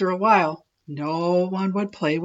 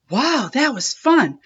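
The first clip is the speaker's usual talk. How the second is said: breathless; 6 semitones higher, 4 dB louder than usual. A narrator's reading. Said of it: "said the new kid breathlessly".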